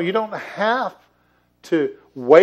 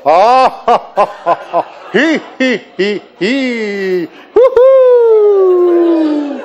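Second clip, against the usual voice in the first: put-on giggles climbing to a loud, high whoop; demonstration laughter; 7 semitones higher, 8 dB louder, much slower than usual. Shown as a demonstration. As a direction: lead a crowd in laughing, ending on woo-hoo. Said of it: "just follow my lead".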